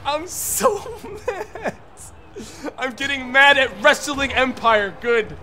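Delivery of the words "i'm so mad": high, slow, breaking into laughter; drawn-out exasperated laugh-talk; mad but laughing. Real, not acted. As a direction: laugh helplessly through your anger.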